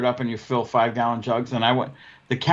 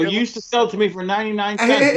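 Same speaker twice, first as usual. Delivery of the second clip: high-pitched haggling complaint; protesting, voice lifted high; retold indignant protest; 8 semitones higher than usual. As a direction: push the pitch up in mock outrage at the price. Retold argument over the price.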